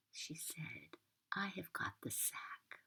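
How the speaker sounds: usual speaking voice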